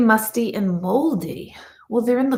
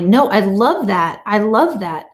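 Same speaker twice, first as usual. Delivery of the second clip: ordinary talk, nothing marked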